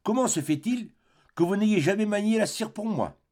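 high-pitched, aggrieved questioning; reproachful. A narrator's reading. Woman asks the artist why he never made her anything, reproachful.

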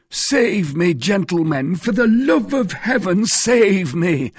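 high, loud, panicked plea; desperate cry. A narrator's reading. Mercer begs the musketeers for help, crying out desperately. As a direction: cry out for rescue at full pitch. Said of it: "cried he".

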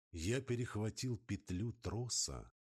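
usual speaking voice

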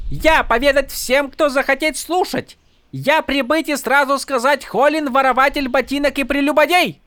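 high, grand public denunciation; mock Horneater accent. A narrator's reading. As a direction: proclaim his crimes to all. Fake noble denounces the soldier.

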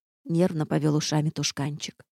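ordinary delivery

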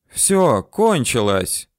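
usual speaking voice

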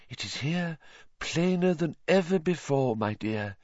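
high, slowed doom-laden seer voice; ominous prophecy. A narrator's reading. Trelawney prophesying doom to Harry, high and ominous. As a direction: foretell the doom with relish.

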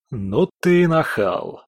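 slow, amused laughing retort. A narrator's reading. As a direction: laugh the words out slowly. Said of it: "рассмеялся Рональд".

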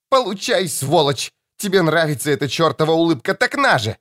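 put-on high, shrill-free angry young woman's cry; furious daughter.

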